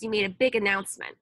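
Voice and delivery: ordinary delivery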